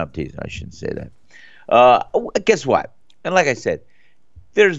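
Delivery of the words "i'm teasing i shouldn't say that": whispered aside. Real, not acted. Aside after teasing.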